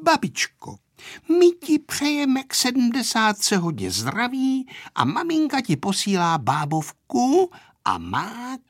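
lightly voiced dutiful granddaughter; little girl congratulating. A narrator's reading. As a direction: recite birthday wishes like a girl. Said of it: "vzpomněla si Aneška".

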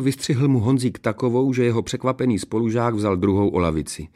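usual speaking voice

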